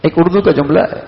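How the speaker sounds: ordinary talk, nothing marked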